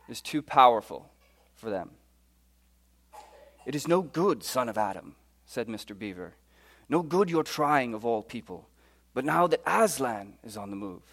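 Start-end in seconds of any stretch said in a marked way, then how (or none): 3.6-11.0 British storybook reading as Mister Beaver; earnest British-accented story voice. Acted. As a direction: read it in your best British accent. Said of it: "in my very best British accent".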